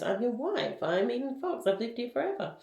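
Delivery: high-pitched, insistent retold protest; mock protesting. Wife tells husband who she is, high mock protest.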